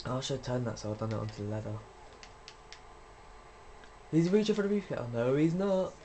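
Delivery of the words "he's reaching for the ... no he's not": high, loud play-by-play; excited commentary. Real, not acted. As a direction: call the action like a fan. Live reacting to opponent.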